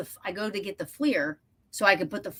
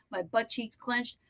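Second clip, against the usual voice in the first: usual speaking voice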